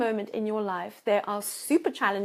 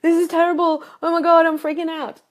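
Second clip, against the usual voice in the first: higher and much louder panicked outburst; mock panic; 7 semitones higher, 10 dB louder than usual. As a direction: play someone freaking out. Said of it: "while you're in the darkness going".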